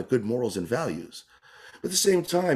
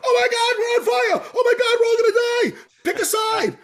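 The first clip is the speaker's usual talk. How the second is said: shrieking far higher and louder, mock alarm; a mock anchor screaming that everyone is doomed; panicked news anchor; 7 dB louder than usual. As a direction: ham up a hysterical TV news anchor. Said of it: "Instead of".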